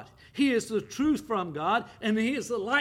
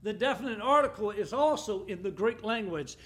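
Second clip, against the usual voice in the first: normal talking voice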